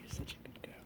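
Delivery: soft whispered praise; whispering. Real, not acted. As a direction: breathe it to the cat. Repeated praise, now whispered.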